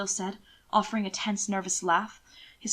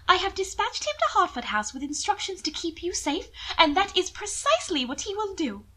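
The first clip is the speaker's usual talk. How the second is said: higher, pitying noblewoman; lightly raised lady's voice, sweetly condescending; Mina's voice; 9 semitones higher than usual. Acted. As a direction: coo over him with false pity. Narrator voices Mina.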